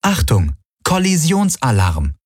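measured computer announcement; ship computer alert. A narrator's reading. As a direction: announce it as a ship's warning system. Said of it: "meldete die Vago".

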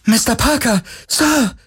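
very high, joyful call; elated high-pitched shout to the boss; childlike joy. A narrator's reading. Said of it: "rief ... mit fast kindlicher Freude".

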